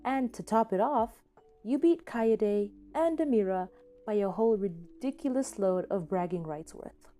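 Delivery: ordinary delivery, nothing marked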